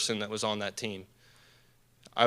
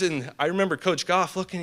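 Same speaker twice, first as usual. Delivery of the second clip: usual speaking voice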